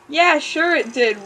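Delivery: ordinary delivery, nothing marked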